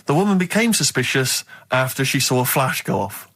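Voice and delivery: normal talking voice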